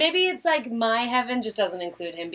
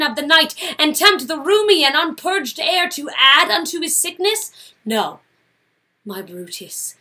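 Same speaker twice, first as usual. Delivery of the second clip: Portia building then fading; 5 semitones higher, 5 dB louder than usual. Acted. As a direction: press him hard, then drop to a hush. Portia confronting Brutus.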